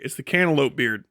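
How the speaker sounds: ordinary delivery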